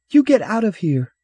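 high, loud shrill order; defensive child. Acted. Boy clutching his box orders stranger away, defensive yell. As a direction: yell it like a frightened, protective little boy.

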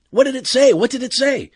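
high, loud, urgent questions; agitated questioning. Acted. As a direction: demand to know, astonished. Urgent question after animal speaks.

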